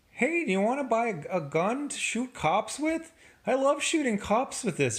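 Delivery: high, fast, not scary villain pitch; a gun seller trying and failing to sound menacing; mock villain. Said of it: "you don't sound scary at all".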